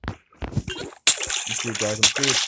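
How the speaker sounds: loud despairing panic; panicked. Real, not acted. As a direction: sure he's doomed. About to die.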